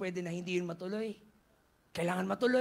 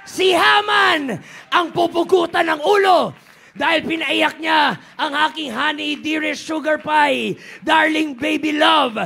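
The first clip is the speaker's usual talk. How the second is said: loud, higher royal fury; angry king's decree; 10 semitones higher, 19 dB louder than usual. Acted. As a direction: pronounce the sentence in a furious roar. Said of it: "Ang sabi ni Xerxes". (pasigaw na utos ng galit na hari)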